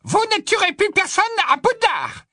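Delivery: high, loud defiant squeak; tiny wizard shouting defiance; squeaky little wizard. Acted. Flitwick defending the castle.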